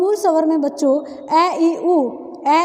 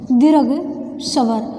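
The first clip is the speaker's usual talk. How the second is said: दीर्घ स्वर said slowly, word by word, as for dictation; 4 semitones lower, much slower than usual. Shown as a demonstration. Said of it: "teacher repeats term for dictation".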